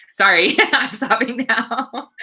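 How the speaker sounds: slow, giggly sorry, breaking up; laughing apology. Real, not acted. Streamer telling viewers she is stopping now, laughing apology. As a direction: apologize through real laughter.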